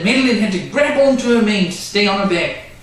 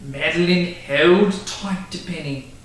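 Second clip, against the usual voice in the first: ordinary delivery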